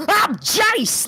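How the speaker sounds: imitating the old dub's rocker Jace; hair metal Jace. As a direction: ham up the glam rocker. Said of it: "that's how he talked".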